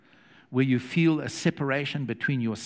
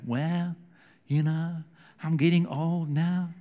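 put-on feeble old man; 3 semitones higher, slower than usual. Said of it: "No, he wouldn't talk like that".

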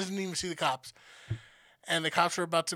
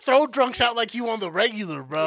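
loud bartender threat; tough talk; 5 semitones higher, 7 dB louder than usual. Retold or imitated. Bartender threatening drunk brother, loud and tough. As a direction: puff up and threaten him. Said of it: "bartender squaring up to brother".

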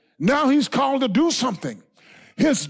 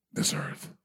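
sudden breathy whisper, far softer; dramatic whisper; 10 dB quieter than usual. Acted. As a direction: drop to a hush on the doom. Dramatic drop after destroy.

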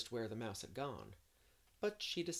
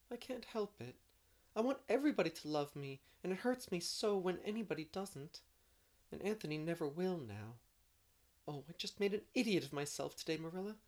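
put-on high, pleading girl's voice; plead, still hurting; upset girl; 6 semitones higher than usual. A narrator's reading.

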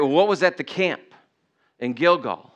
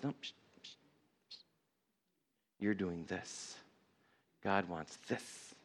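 God's hushing; 7 semitones lower, 19 dB quieter than usual. Acted. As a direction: hush them almost in a whisper. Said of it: "because God says".

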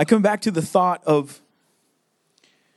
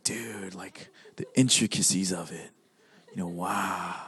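very low, hushed mock reverence; hushed awe; 10 dB quieter than usual. Art lover staring at a painting, hushed mock awe. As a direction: murmur it low, as if awed. Imitating awed art gallery visitors.

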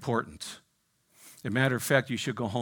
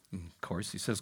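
ordinary talk, nothing marked

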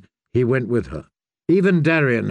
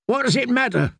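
screamed; 11 semitones higher than usual. A narrator's reading. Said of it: "Darian screamed at him".

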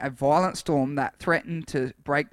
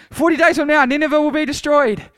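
high, loud proclamation; full-voiced prophetic shout; shouted to crowds; 10 semitones higher, 8 dB louder than usual. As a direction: shout it out as a warning to a whole crowd. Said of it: "he shouted to the crowds".

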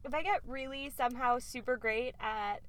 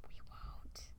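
whispering; 11 dB quieter than usual. Real, not acted. Joking aside about being disorganized.

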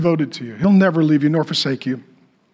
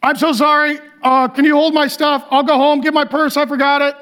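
high, flustered imitation of an apology; apologetic shopper; 8 semitones higher than usual. Embarrassed lady asks the people behind her for help, mock flustered apology. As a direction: play an embarrassed shopper pleading to step out. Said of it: "she feels really bad".

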